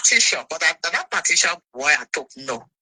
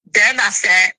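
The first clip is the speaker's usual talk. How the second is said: ordinary talk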